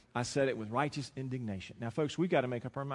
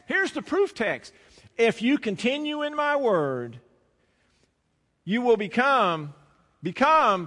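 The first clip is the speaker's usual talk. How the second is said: louder, higher, slow-building sermon delivery; full-force preaching; 5 semitones higher, 7 dB louder than usual. Acted. Preacher pounds the scripture home to the congregation, loud and high. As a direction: drive the text home from the pulpit.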